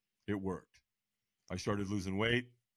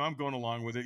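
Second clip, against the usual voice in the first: ordinary talk, nothing marked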